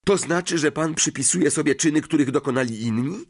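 high-pitched, disbelieving question; incredulous. A narrator's reading. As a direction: start high, challenge him in disbelief. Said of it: "zapytał Harry z niedowierzaniem".

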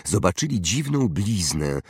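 usual speaking voice

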